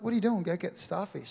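lightly voiced dad, puzzled and urging; impatient dad. Dad urging his son to fetch the starfish, mildly impatient.

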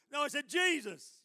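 very loud, high JESUS; emphatic correction. Retold or imitated. Preacher correcting the timid nurse, loud and emphatic. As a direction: boom the name to correct them.

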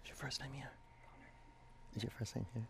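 hushed welcome to a newcomer; whispering. Real, not acted. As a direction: whisper it kindly.

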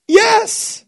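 very high, loud, drawn-out cheer; hammed-up squeal of triumph; jubilant yes. Bob's triumphant reply ending the story.

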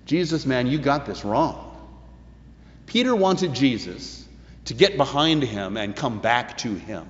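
ordinary talk, nothing marked

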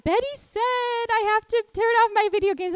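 put-on boy tattling on his sister, high whine; whiny crying child. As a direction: tattle tearfully like a small child. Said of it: "he'd come crying".

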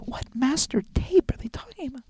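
panicked whispered aside; hushed, voiceless muttering; alarmed whisper. Acted. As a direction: whisper to yourself in disbelief. Alarmed aside while reading letter.

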